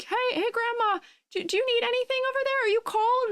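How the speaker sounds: very high, sweet grandchild voice; concerned grandchild. Acted. Grandchild offering grandma help, high-pitched and solicitous. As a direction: check on grandma in a high, eager little voice. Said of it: "You, like, offer her tea or something".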